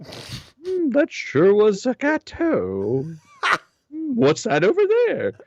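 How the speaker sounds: high, slow, overly polite judge; a prim lady covering her disgust; polite Prue voice. Retold or imitated. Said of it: "How do I not look like an asshole".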